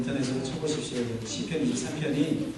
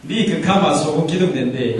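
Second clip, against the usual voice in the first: louder, punchy regional dialect gag; Bible dialect joke; 6 dB louder than usual. Shown as a demonstration. Pastor translates a warning into dialect for laughs, joking regional accent. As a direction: hit the Korean dialect line like a punchline. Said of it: "요거 사투리로".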